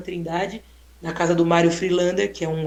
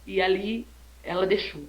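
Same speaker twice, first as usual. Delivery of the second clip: ordinary delivery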